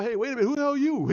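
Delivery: fast, high, loud mock yell; mock scream. Vlogger mocking the missing outcry, loud fast yell. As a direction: blurt it like an alarmed bystander. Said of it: "No one's screaming out".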